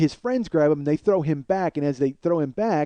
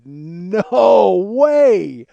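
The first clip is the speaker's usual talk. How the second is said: dawning disbelief; 3 semitones higher, 7 dB louder than usual. Retold or imitated. Retold dawning realisation.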